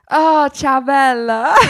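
a hammed-up Italian "ciao, bella"; Italian greeting. Tony greeting Lou in a mock Italian charm. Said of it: "Italian Tony went".

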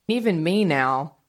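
normal talking voice

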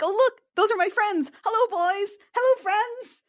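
put-on high-pitched, delighted greeting to old friends; light, high acted delight; joyful Scrooge. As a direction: act out wistful joy, pitched well up. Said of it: "someone who used to experience joy".

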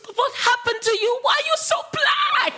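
put-on a girl shocked at a pale woman's dark tan; shocked gasp. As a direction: squeal it out in disbelief. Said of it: "she went gasps".

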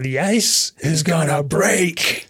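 hammed-up burst of temper, every word stretched; losing his temper. Acting out Walken warning Chris's dad about the ice, losing it. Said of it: "Walken completely loses it".

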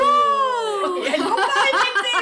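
loud laughing exclaim; cracking up, high and loud; laughing shout. Real, not acted.

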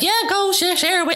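high, eager, carefree; giddy excitement. Retold or imitated. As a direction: wave everyone on happily. Retold eager permission to share.

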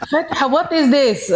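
high, slow put-on mum voice; imitated puzzled mum. Mum answering a sudden "I love you" call, imitated bafflement.

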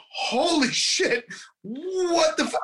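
high, stunned outburst of disbelief; shocked disbelief. Retold or imitated. Viewer blurting out disbelief at the sudden laughter, high and shocked.

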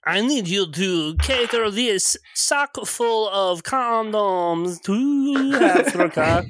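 slow, drawn-out mock Eastern European accent. Game master pitching a quest in mock Eastern European accent. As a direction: stretch the words as a cliche Eastern European. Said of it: "Terrible Eastern European cliches".

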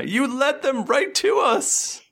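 high, slow, drawn-out wail of blame; accusing voice from the dome. Acted. Game master as voice inside the dome blaming Zerd, wailing accusation. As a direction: stretch the accusation out, high and dismayed. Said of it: "you hear from, from in front of you".

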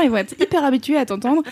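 ordinary delivery

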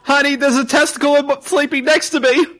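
high, squeaky shocked wife. Acted. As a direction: squeal it like a startled spouse.

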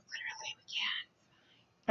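hushed whispered aside; whispering. Real, not acted. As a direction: drop to a whisper. Aside to co-host.

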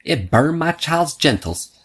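high, slow put-on mother's voice; imitating the mother. Mother complains to him, voiced.

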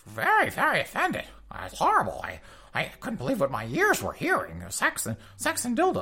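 squeaky high prissy complainer, very offended; mock scandalized falsetto. Diner complaining to the Chili's manager, high mock-offended falsetto. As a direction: squeal it like a pearl-clutching prude. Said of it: "And he's like".

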